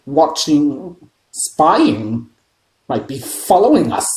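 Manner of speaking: mild wild mutter. A narrator's reading. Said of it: "muttered Uncle Vernon wildly".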